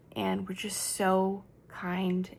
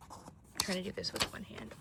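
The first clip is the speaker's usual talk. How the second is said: whispering; 11 dB quieter than usual. Real, not acted. Muttered aside while handling item.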